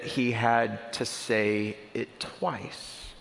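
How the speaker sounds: very slow "had to say it twice"; slowed way down to stress the repetition; slowed for emphasis. Shown as a demonstration. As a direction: drag out "twice" to make the point. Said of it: "saying the word beautiful wasn't enough".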